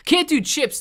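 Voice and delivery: high, loud complaint; loud high protest. Real, not acted. Streamer refusing chips to chat, high and loud. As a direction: blurt it out high. Reacting to chat food talk.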